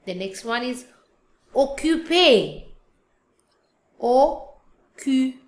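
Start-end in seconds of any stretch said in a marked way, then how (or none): 1.6-5.4 OCCUPÉ slowly as "o - kyu - PAY"; French pronunciation. Shown as a demonstration. Teacher showing the é sound, slow French pronunciation. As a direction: stretch occupé with a clear final é. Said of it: "The sound is".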